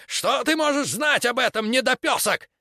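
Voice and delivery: very high, angry sneering; furious high scolding. Acted. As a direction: scorn the boy shrilly. Preacher furious at boy.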